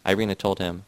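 ordinary delivery

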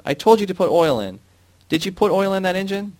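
high, loud furious questions; harsh barking commands. A narrator's reading. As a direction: rage at the worker. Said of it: "the foreman bellowed".

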